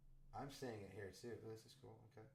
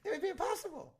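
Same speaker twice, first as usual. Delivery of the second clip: laughing disbelief; 5 dB louder than usual. Real, not acted. Laughing at absurd comment math.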